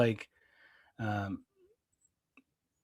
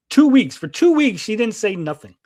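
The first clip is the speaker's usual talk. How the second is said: raised, louder indignant outburst; retold pissed-off disbelief; 6 semitones higher, 5 dB louder than usual. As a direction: re-enact being pissed off, pitch jumping up. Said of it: "I'm fucking pissed".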